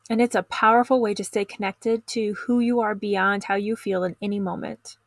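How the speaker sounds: ordinary delivery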